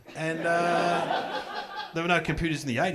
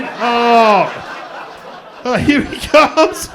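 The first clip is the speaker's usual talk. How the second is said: slow, high, loud taunting; sing-song schoolyard jeer; loud drawn-out mocking kid; 6 semitones higher, 11 dB louder, much slower than usual. Kids mocking dad.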